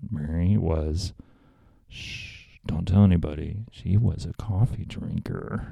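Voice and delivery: low, soft, conspiratorial confiding; hushed mock secret. Host pretending to share Mary's shameful secret, hushed. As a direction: let slip a scandalous secret under your breath.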